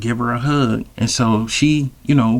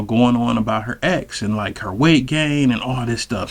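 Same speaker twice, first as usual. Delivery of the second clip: ordinary talk, nothing marked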